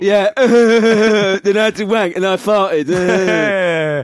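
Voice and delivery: laughing loud and slow. Acted. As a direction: blurt it out through laughter. Mock acting out the bad sex.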